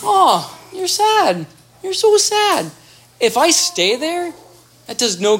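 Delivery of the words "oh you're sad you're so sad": put-on very high cooing sympathy to a crying baby; high baby-talk mirroring of sadness; sing-song mirroring to baby. Shown as a demonstration. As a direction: coo it up high like talking to an infant. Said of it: "and I go".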